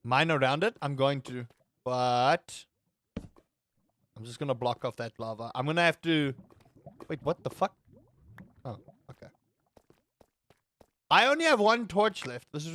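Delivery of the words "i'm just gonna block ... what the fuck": ordinary delivery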